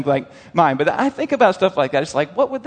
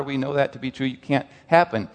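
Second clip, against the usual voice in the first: ordinary talk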